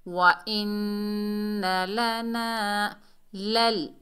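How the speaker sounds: slow, drawn-out tajweed chant; measured Quranic recitation. Shown as a demonstration. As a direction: recite each syllable long and steady. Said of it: "लाम को लाम से मिलाएंगे".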